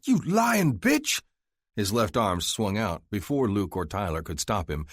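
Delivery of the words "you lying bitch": enraged slow shout, much higher and louder; furious yell. Acted. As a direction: scream the insult in outrage. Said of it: "He yelled".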